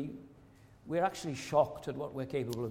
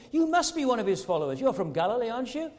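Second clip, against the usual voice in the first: imitating an accuser, higher and louder; mock accusation; 6 semitones higher, 4 dB louder, faster than usual. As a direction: press him like a suspicious bystander. Acting bystander accusing Peter.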